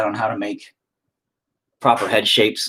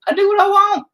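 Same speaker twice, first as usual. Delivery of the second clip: put-on childish squeak; 4 dB louder than usual. Mock defiance repeated.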